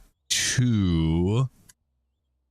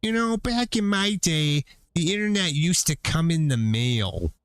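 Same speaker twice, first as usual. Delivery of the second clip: high, creaky granny voice; old lady voice; 8 semitones higher than usual. Retold or imitated. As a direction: play a little old lady looking back. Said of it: "in an old lady voice".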